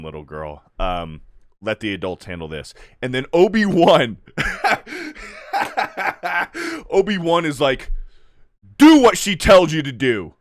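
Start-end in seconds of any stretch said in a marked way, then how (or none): 0.0-2.7 mock condescension. Voices condescending adult to Leia. 8.8-10.3 loud, raised mock command; hammed-up barked order; Obi-Wan's stern order. Mocks Obi-Wan's stern order, laughing around it.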